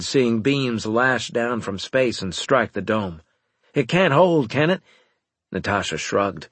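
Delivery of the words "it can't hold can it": worried. A narrator's reading. Narrator fears for the dome.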